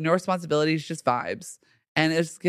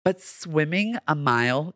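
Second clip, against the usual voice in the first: ordinary delivery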